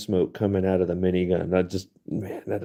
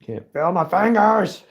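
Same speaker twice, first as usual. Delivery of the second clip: high, slow, strained whine from the cold; freezing cold whine; 11 semitones higher, 6 dB louder than usual. Real, not acted. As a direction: whimper it out with numb fingers. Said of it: "Oh, it's so cold".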